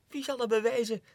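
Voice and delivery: high, loud challenge; defiant outburst. Acted. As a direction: fling the question out. (uitdagend uitgeroepen)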